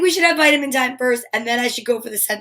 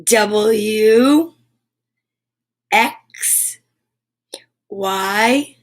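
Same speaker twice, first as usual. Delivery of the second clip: letters stretched long, spaced by pauses; slow, lingering alphabet recitation; drawn out; 2 semitones lower, much slower than usual. Shown as a demonstration. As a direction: recite each letter slowly, drawing it out. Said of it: "draw it out".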